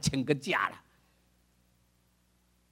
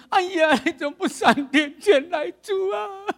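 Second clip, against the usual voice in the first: crying, high-pitched shouted lament, drawn out; wailing grieving sister; 11 semitones higher, slower than usual. Retold or imitated. Mary crying out to Jesus at the tomb, high wailing sobs. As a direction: wail it like a sister whose brother died. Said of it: "你不会哭得像我这么惨吧".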